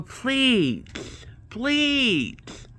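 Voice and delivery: high, drawn-out begging "please"; pleading. Retold or imitated. Begging the IT guy for help.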